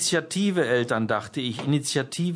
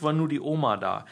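ordinary delivery, nothing marked

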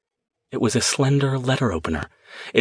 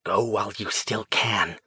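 possessed whisper; 4 dB quieter than usual. A narrator's reading. Possessed woman warning the mage, soft rasping threat. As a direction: breathe the warning like a thing not human. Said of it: "the voice that was not Lindsay's whispered".

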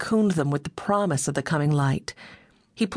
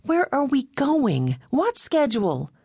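Meg's high, slow, put-out voice; a grumpy young woman, voiced higher; high, sulky Meg wanting answers; out of sorts; 9 semitones higher than usual. A narrator's reading. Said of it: "still sounding out of sorts".

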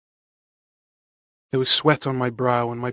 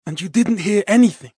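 halting; 7 semitones higher, 5 dB louder than usual. Acted. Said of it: "he asked haltingly".

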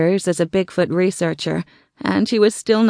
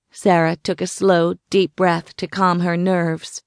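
plain reading voice

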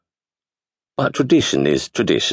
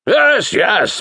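indulgent boom. A narrator's reading. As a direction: boom it kindly, humouring him. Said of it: "boomed the wizard indulgently".

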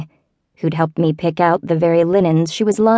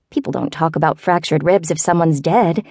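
plain reading voice

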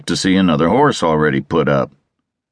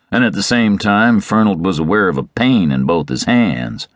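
plain reading voice

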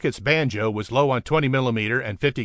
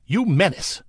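higher, venomous radio outburst; spat; 6 semitones higher than usual. A narrator's reading. Commanding officer berating Rocket over the frequency, spat. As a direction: spit it out in contempt. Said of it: "spat Leifer over the frequency".

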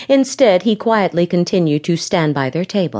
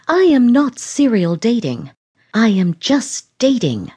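tight, pitched-up angry hiss; 5 semitones higher than usual. A narrator's reading. Lauren hissing back at Jim over dating. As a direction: bite the words out at him, pitched up. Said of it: "she hissed".

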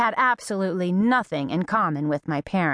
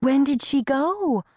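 alarmed question; 7 semitones higher than usual. A narrator's reading. Neighbor's alarmed question about runaway.

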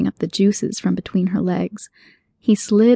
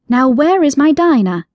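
put-on raised, earnest girl's voice; a polite girl demanding her cat back; firm yet polite young heroine; young Alice; 6 semitones higher, 5 dB louder than usual. Alice character voice.

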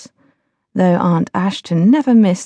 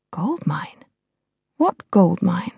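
breathless whispered thought; stunned inner whisper; 3 dB quieter, slower than usual. A narrator's reading. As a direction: mouth it to yourself in disbelief.

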